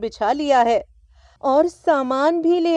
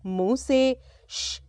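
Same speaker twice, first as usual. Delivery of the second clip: usual speaking voice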